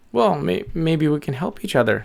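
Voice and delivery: high, hesitant bird voice; tentative little bird. Acted. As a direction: suggest it shyly as a small bird. Bird suggests helping each other.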